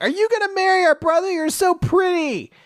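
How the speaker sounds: put-on very high, loud little-kid squeal; imitating thrilled children; excited kids. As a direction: gush like overexcited younger siblings. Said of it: "they're really excited 'cause they're like".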